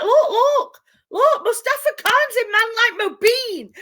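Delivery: imitating dad calling up the stairs, high and loud; dad yelling upstairs to his wife about the TV, high and excited; excited shout. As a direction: shout it out thrilled, pointing at the telly. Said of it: "he shouts me downstairs".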